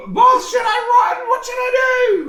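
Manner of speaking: high, loud, frantic squeal of a scared minion; panicked sidekick yell. Acted. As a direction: shriek it out like a terrified little goblin.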